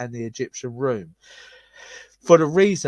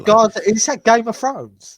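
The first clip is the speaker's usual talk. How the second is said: excited; 9 semitones higher, 9 dB louder than usual. Real, not acted. Laughing surprise at odd phrase.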